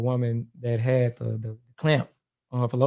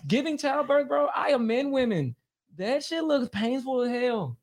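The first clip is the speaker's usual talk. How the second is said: laughing disbelief; 11 semitones higher, 5 dB quieter, slower than usual. Real, not acted. Laughing about childbirth pain.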